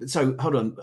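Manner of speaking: usual speaking voice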